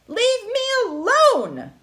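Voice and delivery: high, loud and slow exasperated shout; old woman shouting at snacking goats. Acted. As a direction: drag it out at the goats. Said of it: "the old woman shouted".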